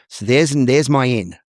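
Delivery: ordinary talk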